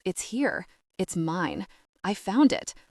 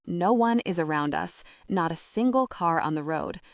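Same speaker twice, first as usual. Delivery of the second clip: ordinary delivery, nothing marked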